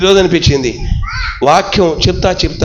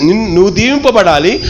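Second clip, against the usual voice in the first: usual speaking voice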